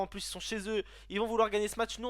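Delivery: ordinary talk, nothing marked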